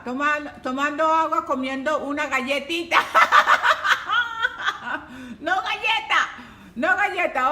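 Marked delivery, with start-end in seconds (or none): 3.0-5.3 a long burst of laughter; amused laugh at a viewer's snack; real laughter. Real, not acted. 5.4-7.5 playful scolding through laughter; teasing a viewer about her cookie, laughing; laughing tease. Real, not acted.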